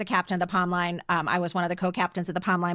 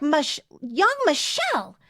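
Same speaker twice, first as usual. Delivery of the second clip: slow, stern mock scold of a naughty friend; 6 semitones higher, slower than usual. Telling a friend off for flicking ice cream, mock stern. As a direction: drag out her name like a stern parent. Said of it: "I'm, like, scolding her".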